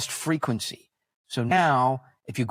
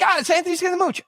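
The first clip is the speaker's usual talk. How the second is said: very high offhand reply; mock producer; much faster than usual. Producer answering the host, high mock voice. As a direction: toss the name over lightly. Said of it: "she's like".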